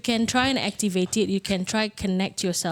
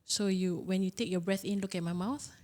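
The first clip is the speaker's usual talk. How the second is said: soft, hushed meditation guide; guided meditation; 6 dB quieter than usual. Acted. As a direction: murmur the breathing cues gently. Leads a breathing exercise, softer.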